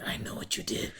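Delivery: whispered mock threat; playful spooky whisper; creepy whisper. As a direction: breathe it like an anonymous stalker. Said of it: "We're gonna be like".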